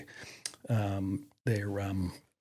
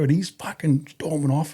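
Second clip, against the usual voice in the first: ordinary talk, nothing marked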